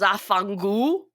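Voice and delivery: slow, drawn-out mock New York Italian curse. Imagining Jared Leto as Paolo Gucci cursing, slow, hammed-up New York Italian.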